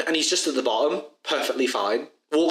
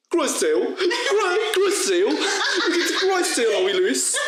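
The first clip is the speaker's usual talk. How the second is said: put-on high, laughing send-up of the tutor's London accent; mocking London accent. Students at the back mocking the tutor's London accent. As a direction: parrot his London vowels back at him, giggling. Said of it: "sat in the back going".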